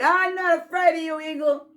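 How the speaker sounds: a small rabbit yelling up at the sky; rabbit shouting. Acted. Rabbit yelling bravely up at the eagle, loud and high. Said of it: "till rabbit started shouting".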